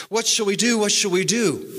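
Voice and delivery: retold cry of panic to the master; panicked cry. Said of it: "he cries out to his master".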